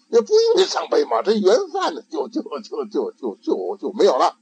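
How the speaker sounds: put-on high, bright boyish questioning; child's voice. Ikkyu leading his master on, in a high child voice. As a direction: play a clever little monk.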